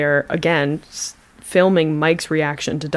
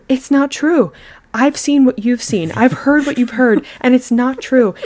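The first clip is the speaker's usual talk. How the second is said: higher, insistent imitation of her; retold near-angry insistence; 6 semitones higher, 4 dB louder than usual. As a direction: hammer out her denial, almost angry. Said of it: "she's almost getting angry with him".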